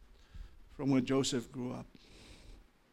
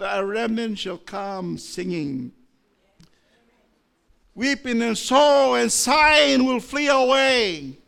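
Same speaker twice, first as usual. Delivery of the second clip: slow, loud, much higher scripture proclamation; prophetic preaching; 9 semitones higher, 12 dB louder, slower than usual. Acted. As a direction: ring out the promise. Quoting Isaiah in preaching.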